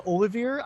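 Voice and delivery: "Olivier" ending in a sounded R, oh-li-veer; own Dutch-style pronunciation. Shown as a demonstration. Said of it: "I say Olivier".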